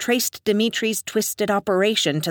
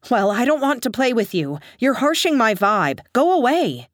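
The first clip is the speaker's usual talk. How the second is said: higher, louder brush-off building; arrogant; 7 semitones higher, 4 dB louder than usual. A narrator's reading. Narrator sending the dom away, louder and higher. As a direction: dismiss him haughtily.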